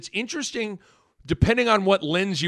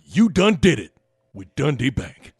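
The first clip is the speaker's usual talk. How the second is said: lightly voiced drawling announcer catchphrase; Mark Jackson impression; 3 semitones lower than usual. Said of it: "Mark Jackson were the spokesperson".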